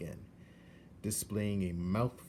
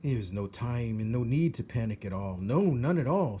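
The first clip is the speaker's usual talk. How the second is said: louder, oily calm rat voice; smooth rat reassuring; 3 semitones higher, 6 dB louder than usual. Acted. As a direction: soothe her like a silky schemer. Roscoe soothes Meg.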